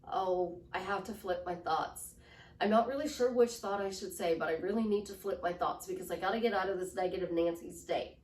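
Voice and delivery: low, monotone, joyless self-talk; robotic. Acted. Person reciting how to flip their thoughts, robotic. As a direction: drone through it mechanically. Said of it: "And then you go robotic".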